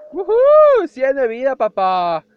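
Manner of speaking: very high, loud whoop; cheering. Real, not acted. Gamer cheering at full health, excited whoop. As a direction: celebrate the full health bar.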